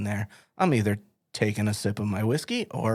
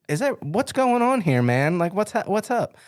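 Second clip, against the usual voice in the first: baffled question; 10 semitones higher, 3 dB louder than usual. Retold or imitated. Baffled by Rusty's pauses on phone.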